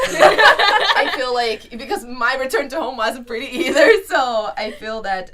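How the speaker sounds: loud, slow voice breaking with tears; real tearful. Real, not acted. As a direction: push through the words while crying. Emotional about hard journey home.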